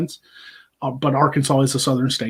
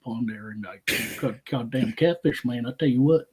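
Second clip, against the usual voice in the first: Boomhauer-like Southern mumbling; mumbled Southern accent. Retold or imitated. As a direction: run the words together in a Southern mumble. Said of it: "he mumbles in Southern".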